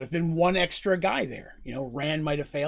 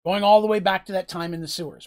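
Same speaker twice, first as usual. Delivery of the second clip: ordinary talk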